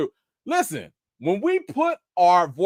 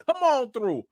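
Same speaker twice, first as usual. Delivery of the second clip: ordinary talk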